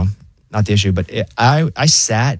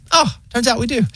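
high, casual robot-assistant voice; weirdly chill operator; 12 semitones higher than usual. Acted. Pharmacy AI corrects itself, weirdly chill. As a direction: toss it off breezily, pitched up. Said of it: "it's so weirdly chill".

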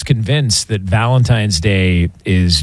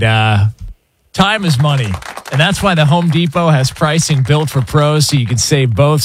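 higher, energetic sponsor read; radio ad read; 4 semitones higher than usual. Real, not acted. Host reads the Home Depot sponsor spot, radio ad read. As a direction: sell it like a radio host reading copy.

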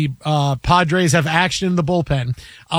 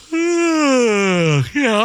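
mock tired sigh; 6 semitones higher than usual. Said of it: "I'm tired".

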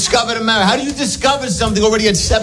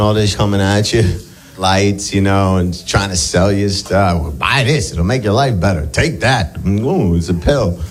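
dazed stoner; 13 semitones lower than usual. Acted. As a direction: muse on life like a burned-out stoner. Stoner voice held throughout.

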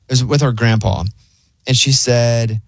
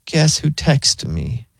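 slow teasing; much slower than usual. Shown as a demonstration. Demo of the prank line.

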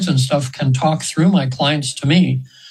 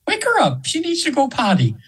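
imitating the angel's voice; 11 semitones higher than usual. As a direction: lift way up into a dainty angel voice. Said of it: "I'm almost using her voice now".

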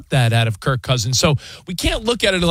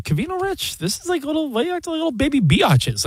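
imitated scornful listeners; 10 semitones higher than usual. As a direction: voice the doubters, pitched well up. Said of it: "there's people thinking right now".

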